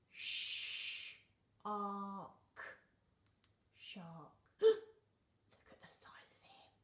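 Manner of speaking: big gasp, then a slow breathy whisper; amazed gasp. Pretend. Teacher amazed at the shark picture, gasping in a slow whisper.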